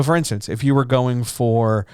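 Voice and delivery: ordinary delivery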